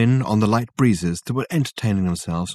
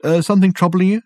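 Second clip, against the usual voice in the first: a higher, louder startled question; taken aback; 9 semitones higher, 5 dB louder than usual. Acted. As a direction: caught off guard, asking quickly. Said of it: "taken aback".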